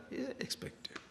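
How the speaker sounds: breathy whispered aside; whispered afterthought to students. Real, not acted. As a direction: drop to a whisper for the point.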